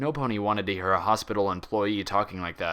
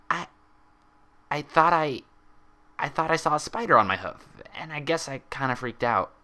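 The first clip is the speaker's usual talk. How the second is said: higher, stumbling, forced casual; nervous lie; 6 semitones higher than usual. A narrator's reading. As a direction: cover up with a shaky fake grin. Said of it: "nervous grin".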